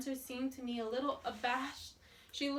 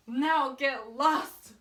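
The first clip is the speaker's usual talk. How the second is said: loud, slow get lost; slow furious dismissal; 10 dB louder, much slower than usual. A narrator's reading. Alfred scalding the girl then ordering her off, loud and slow. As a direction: spit it out to drive her off.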